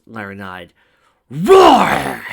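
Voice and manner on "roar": high, loud, full-throated monster roar. A narrator's reading. Tracy tries to scare Twilight with a big roar. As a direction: roar as if to scare her stiff. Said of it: "Tracy roared at the top of her lungs".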